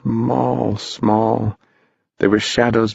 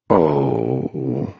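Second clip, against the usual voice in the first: mournful; 6 semitones lower than usual. A narrator's reading. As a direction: moan it low and drawn out. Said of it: "mourned Mister Mound Shroud".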